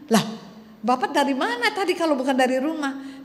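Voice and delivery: can't believe what she hears; incredulous clerk. Acted. Clerk disbelieving old man. (petugas tak percaya, bertanya dengan kesal dan nada tinggi)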